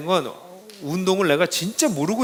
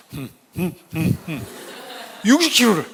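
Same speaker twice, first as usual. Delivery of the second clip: mock straining grunts. Imitating trainer lifting sixty kilos.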